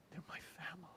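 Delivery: whispered, near tears; tearful whisper. Retold or imitated. Young priest confessing his love for the people, tearful whisper. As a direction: breathe it out as if about to cry.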